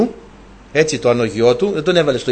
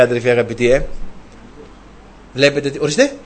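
ordinary talk

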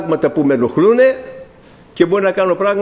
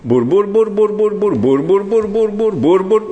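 mock nonstop "bour-bour" babble on one raised note; imitated nonstop praying; 3 semitones higher, slower than usual. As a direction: mimic someone plugged in and praying without a break. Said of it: "έμπαινε και στην πρίζα και ξεκίναγε".

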